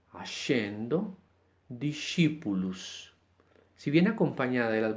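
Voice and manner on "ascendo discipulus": ascendo and discipulus read slowly, SC as sh; slow Latin examples. Shown as a demonstration. Teacher reading example words, slow. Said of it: "como en la palabra".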